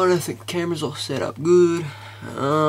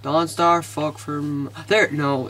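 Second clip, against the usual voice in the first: ordinary talk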